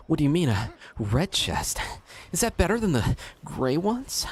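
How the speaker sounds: put-on high girlish anime voice, rising; high cute anime girl. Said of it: "an anime voice".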